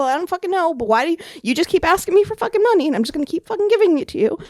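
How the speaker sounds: loud, high-pitched exasperation; heated rant. Real, not acted. Ranting about bills and copays.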